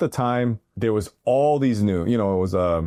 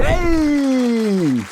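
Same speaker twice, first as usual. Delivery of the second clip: long, high, drawn-out hey; joyful greeting shout; 13 semitones higher, 5 dB louder than usual. Retold or imitated. Gangsters welcoming Henry outside the courthouse, drawn-out loud hey. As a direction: call out like gangsters welcoming a friend.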